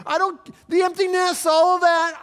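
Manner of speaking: mock the careless listener's bravado; hammed-up careless sinner, shouting high; mocking the stubborn one. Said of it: "are you positioning yourselves".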